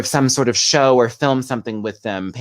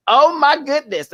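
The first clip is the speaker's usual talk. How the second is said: high, slow, gasping "Oh my goodness"; shrieked delight; 4 dB louder than usual. Retold or imitated. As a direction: gush like a starstruck host. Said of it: "her going".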